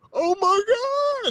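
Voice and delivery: very high, slow, loud wail; terrified scream. Retold or imitated. First-time jumper out on the wing, terrified scream. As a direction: drag it out in acted terror.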